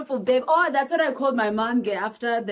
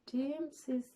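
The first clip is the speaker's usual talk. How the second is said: much softer, slow, drained voice; weak soft reply; 4 semitones higher, 12 dB quieter, slower than usual. Retold or imitated. Paralyzed mother weakly answering the nurse, faint.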